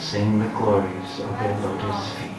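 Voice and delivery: ordinary talk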